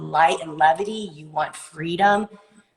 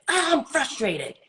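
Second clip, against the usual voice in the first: mock frustrated; 8 semitones higher than usual. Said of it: "You look like you are frustrated".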